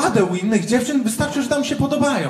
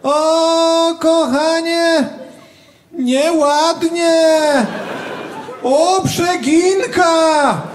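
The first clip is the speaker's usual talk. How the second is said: hammed-up jealousy; 6 semitones higher, much slower than usual. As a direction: overact being jealous. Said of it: "nie mam takich umiejętności aktorskich".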